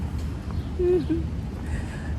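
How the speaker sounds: a high trailing laugh; laughter. Real, not acted. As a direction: laugh it off, still amused. Still laughing at son's word.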